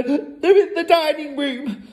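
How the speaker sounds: high-pitched frightened mother; panicked hiss. A narrator's reading. Mother locates the burglars for her family, panicking. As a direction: squeal it in hammed-up fear. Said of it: "hissed the mother".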